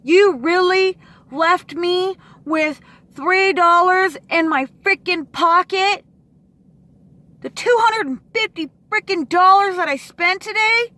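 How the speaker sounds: demanding to know where the money went; outraged disbelief. Real, not acted. Venting to viewers about lost money, loud outraged disbelief.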